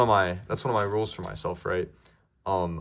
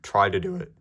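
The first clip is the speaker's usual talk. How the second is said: voice crack; 4 dB louder than usual. Real, not acted. Urging viewers to try it, with an accidental voice crack. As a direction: voice cracking upward on "do it". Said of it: "that was a terrible voice crack".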